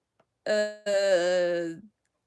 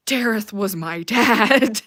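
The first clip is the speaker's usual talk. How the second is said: slow, booming declaration; loud exclamation; 11 dB louder, slower than usual. Real, not acted. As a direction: shout it out word by word. Exclaims over a favorite character.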